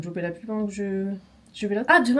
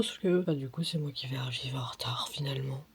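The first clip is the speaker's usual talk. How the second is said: low hushed whisper; 8 semitones lower, 9 dB quieter than usual. Real, not acted. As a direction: whisper it under your breath. Whispered aside. (chuchoté)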